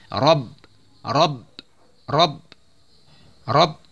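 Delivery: rob with a pressed doubled ba; tajwid drill. Shown as a demonstration. Teacher drills the ba tasydid, pressed rob. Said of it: "ba tasydid ditekan".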